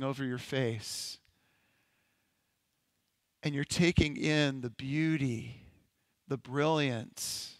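unhurried guided imagery with long pauses; measured, contemplative meditation voice. Acted. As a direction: lead listeners gently into a daydream, leaving space between phrases. Leads a guided imagination exercise.

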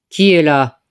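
a deep threatening growl of a question; gruff big voice. A narrator's reading. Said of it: "demanda une grosse voix". (grosse voix grave du loup)

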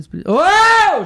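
very high, loud drawn-out cry; mock outburst. Catching himself on Espírito, high loud slow cry. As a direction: bellow the holy name. Blurting holy name.

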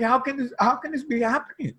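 high, strained, near-crying question; tearful disbelief. Retold or imitated. Moved by his dying mother, high tearful disbelief. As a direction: ask through tears. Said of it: "I was bawling".

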